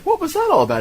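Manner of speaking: high-pitched puzzled movie woman; imitated woman. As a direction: pitch it way up, imitating her question. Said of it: "and she's like".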